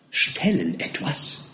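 very slow, soft, baffled echo; puzzled question. A narrator's reading. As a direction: repeat it slowly, not understanding. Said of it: "fragte Mister Winkle".

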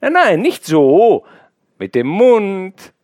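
slow, high and loud teasing; playful insistence. Acted. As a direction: drag it out, coaxing her playfully. Boy teasing girl with cherries.

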